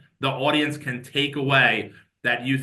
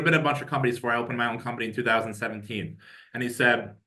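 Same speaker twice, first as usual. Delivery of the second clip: ordinary talk, nothing marked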